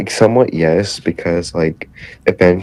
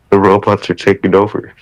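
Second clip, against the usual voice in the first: ordinary talk, nothing marked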